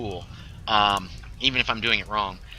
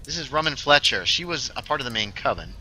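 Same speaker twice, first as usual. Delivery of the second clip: usual speaking voice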